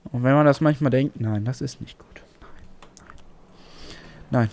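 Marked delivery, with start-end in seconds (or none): 1.2-3.2 breathy whispered dismay to himself. Real, not acted. Gamer whispering to himself about the bad play. Quiet self-talk after loss.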